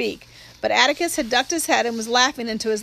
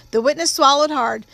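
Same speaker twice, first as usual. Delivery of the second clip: plain reading voice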